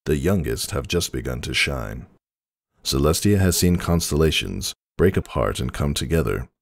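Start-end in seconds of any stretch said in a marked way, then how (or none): none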